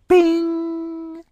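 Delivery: high ringing bing; ping like an idea lighting up; bing sound. A narrator's reading. Sound effect in story.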